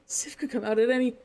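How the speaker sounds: usual speaking voice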